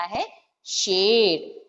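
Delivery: शेर said slowly with a light ए sound; drawn-out शेर; slow clear demo. Shown as a demonstration. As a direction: stretch शेर gently. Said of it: "उसको ए पढ़ते हैं शेर".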